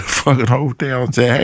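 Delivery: ordinary delivery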